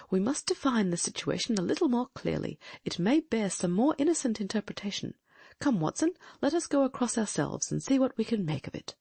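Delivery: alert, intent gentleman detective; keen detective voice. Acted. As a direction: voice a sharp, watchful sleuth. Holmes voiced in a reading.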